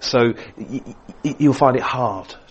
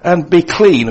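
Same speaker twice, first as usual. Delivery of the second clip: much louder and higher, a ringing cry; ringing proclamation; 7 semitones higher, 12 dB louder, slower than usual. Real, not acted. Preacher crying out Jesus' healing command, loud and high. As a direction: burst out with it like a proclamation.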